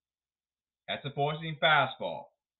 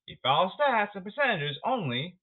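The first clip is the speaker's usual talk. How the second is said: ordinary talk, nothing marked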